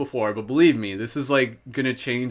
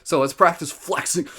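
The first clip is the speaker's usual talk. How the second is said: usual speaking voice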